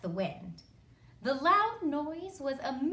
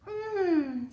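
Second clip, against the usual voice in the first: long, higher yummy hum; relishing storybook "mmm"; savouring "mmm"; 7 semitones higher, 4 dB louder than usual. A narrator's reading. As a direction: hum with delight at a delicious smell. Goldilocks smells the pudding.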